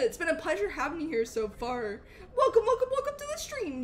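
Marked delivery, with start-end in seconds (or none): 2.4-3.8 high, bubbly greeting; excited welcome. Real, not acted. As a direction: welcome them in a high, eager rush. Streamer welcomes new follower.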